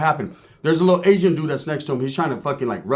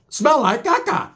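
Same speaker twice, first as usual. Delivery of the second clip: high and slow; drawn-out punchline; 10 semitones higher, slower than usual. Acted. Comedian drawing out the gross punchline. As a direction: stretch the word caca out.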